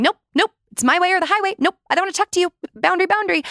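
high, rapid-fire brush-off; mock dismissive refusal. Mimicking someone who misuses boundaries to shut people off, dismissive.

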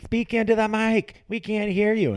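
high and loud, mocking demand; mock yell. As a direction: shout it like nagging fans. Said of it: "everyone messaging you".

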